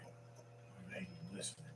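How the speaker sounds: hushed and unvoiced; quiet aside. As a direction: whisper it to yourself. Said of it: "where you be like".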